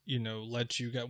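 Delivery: ordinary delivery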